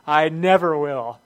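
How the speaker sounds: "I never will" loud and slow with never weighted; boastful stress on never. Retold or imitated. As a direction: draw out "never" as a loud vow. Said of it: "there's that famous word again".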